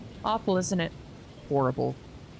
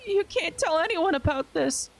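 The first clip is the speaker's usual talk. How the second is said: much higher, pleading through tears; tearful plea; 11 semitones higher than usual. Acted. Hedwig caught naked, begging Eustace in tears. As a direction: beg her not to tell, on the verge of sobbing. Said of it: "I burst into tears".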